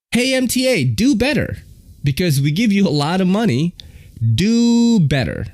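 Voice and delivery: high-pitched comic telling-off; mock scolding. Real, not acted. Mock scolding of transit agency.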